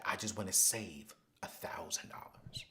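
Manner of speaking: retold goal, whispered low. Young lady recalling her goal to Anthony, whispered. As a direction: hush it like a private confession. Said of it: "can I whisper something in your ear".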